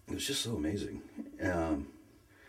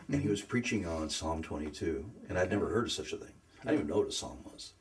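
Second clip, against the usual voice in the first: ordinary talk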